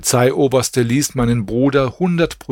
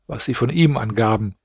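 ordinary delivery

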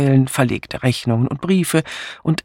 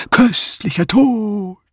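high, drawn-out ghostly call; sepulchral; 8 semitones higher, slower than usual. A narrator's reading. Patrick teases the frightened Miss Banner, sepulchral and slow. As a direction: stretch it out like a voice from the tomb. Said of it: "rief Patrick mit Grabesstimme".

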